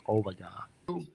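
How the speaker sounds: slow, hushed, almost breathless oh my God; awed hush. Retold or imitated. Veteran reading his decision letter, slow hushed awe. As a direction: let it out slowly and softly, overwhelmed.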